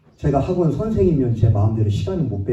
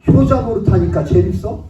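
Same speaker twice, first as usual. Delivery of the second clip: higher, louder mocking taunt with a rising end; sick father taunting his son, loud and sarcastic; 7 semitones higher, 6 dB louder than usual. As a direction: throw the jab again, pitched up and rising. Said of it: "이러더라고요".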